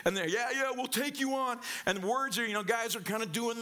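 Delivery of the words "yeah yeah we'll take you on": higher, cocky posturing voice; tough-guy reply. Retold or imitated. Brother's two friends posturing back, cocky and high. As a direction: puff up and call their bluff.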